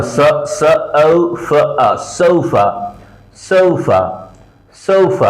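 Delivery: সোফা split into sounds, then blended as "SOH-fuh"; English সোফা with a gliding "oh" and short final uh; sounding out. Shown as a demonstration. Teacher breaks সোফা down for learners, then models it whole. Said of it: "ও sound".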